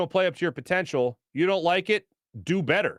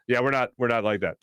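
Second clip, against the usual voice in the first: ordinary talk, nothing marked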